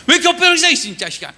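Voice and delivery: high, loud burst of mock outrage; hammed-up telling-off; rough scolding. God chewing out Joshua lying face down, mock-angry shout. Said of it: "이러는 것 같으세요?".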